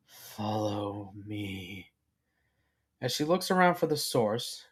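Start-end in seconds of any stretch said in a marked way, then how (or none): 0.1-1.9 soft, low and very slow; a distant call, hushed and drawn out; faint voice. A narrator's reading. Mysterious unseen voice beckons the filly, faint. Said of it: "she hears a faint voice say".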